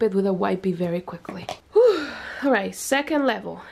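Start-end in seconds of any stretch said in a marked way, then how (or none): 1.7-2.1 high, loud puff of breath; breathy exhale between topics; whew of exertion. Real, not acted. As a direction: blow out a big winded whew. Exhales after long explanation.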